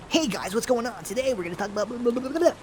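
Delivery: upbeat influencer intro voice; chirpy hey-guys YouTuber voice. Retold or imitated. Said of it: "the YouTuber voice or the influencer voice".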